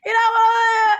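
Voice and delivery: high, loud laugh-through words; laughing shout. Real, not acted. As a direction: break up laughing while talking. Laughing fit mid-joke.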